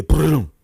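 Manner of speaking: loud mock gunshot; one last boom. Storyteller imitating the last shot, loud boom. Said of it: "And then you hear".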